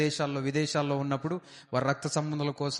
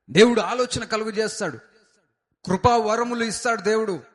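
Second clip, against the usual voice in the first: high, loud exhortation from the pulpit; rally the congregation with full-voiced fervour; fervent preaching; 4 semitones higher, 7 dB louder than usual. Pastor exhorting the congregation to believe, loud fervent preaching.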